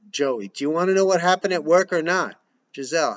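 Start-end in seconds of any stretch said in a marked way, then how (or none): 0.5-2.4 pushy, raised voice; impatient. Acted. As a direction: demand an answer. Joey pressing impatiently.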